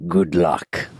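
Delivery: low, drawn-out villain quote; good luck voice. Retold or imitated. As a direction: imitate a movie villain, low and deliberate. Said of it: "That's the good luck voice".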